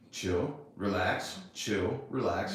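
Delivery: slow soft chill relax to himself; calming self-talk. Retold or imitated. To himself when she has PMS, slow soft self-calming. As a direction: talk yourself down slowly and quietly. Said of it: "I'm like".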